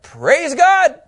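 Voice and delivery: high, exultant exclamation; Paul's joyful outburst, acted; acted rejoicing. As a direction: proclaim it with rising delight. Acting Paul rejoicing.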